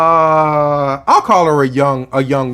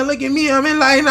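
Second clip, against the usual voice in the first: mock boast; 9 semitones higher, faster than usual. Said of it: "I don't run around saying".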